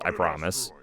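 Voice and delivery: ordinary delivery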